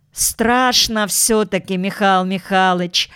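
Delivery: plain reading voice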